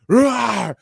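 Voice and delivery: sudden loud, high roar; imitated dinosaur roar. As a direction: roar to startle someone from behind. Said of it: "come up behind me and he'll go".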